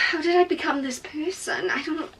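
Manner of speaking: soft bewildered sigh. Real, not acted. Before a run straight to the airport, soft bewildered sigh. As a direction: breathe it out, baffled by yourself.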